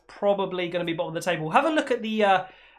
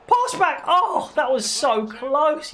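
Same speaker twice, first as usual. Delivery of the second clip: surprised; 7 semitones higher, 3 dB louder, slower than usual. Real, not acted. Near miss in the game.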